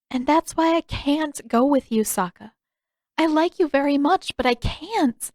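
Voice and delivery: a hint of a princess letting him down gently; Yue, regretful. A narrator's reading. Yue refuses, voiced higher.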